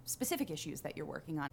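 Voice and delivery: ordinary talk, nothing marked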